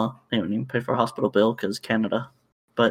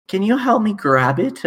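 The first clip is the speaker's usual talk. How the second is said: higher, eager customer asking; mock pleading lady; 9 semitones higher, 3 dB louder than usual. Lady asks the cashier to help catch the fawn, high and pleading. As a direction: whine it like a pushy shopper.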